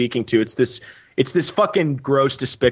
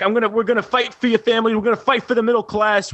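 higher, fast, pumped-up politician pledge; mock campaign promise; 8 semitones higher, faster than usual. Mocking Weiner promising voters, pumped-up stump voice. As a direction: sell it like a stump speech. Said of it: "He's like".

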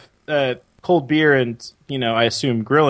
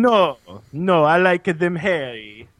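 put-on French accent. Host plays the French woman answering back, French accent. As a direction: protest in mock French English.

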